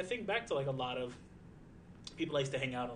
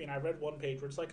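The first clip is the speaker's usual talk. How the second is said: normal talking voice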